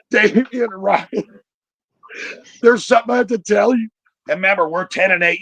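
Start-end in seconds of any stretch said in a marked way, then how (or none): 0.0-3.9 imitating mom's teary confession; mock crying mom. Mom tearfully tells her boys a secret, mock sobbing. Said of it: "she's bawling her eyes out".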